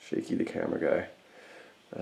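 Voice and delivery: ordinary talk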